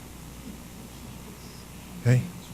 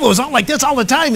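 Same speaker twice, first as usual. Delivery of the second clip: fast, rushed and pitched up; frantic pace; 9 semitones higher, 3 dB louder, faster than usual. Shown as a demonstration. Speaker showing her old frantic speaking pace. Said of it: "Mine was always frantic".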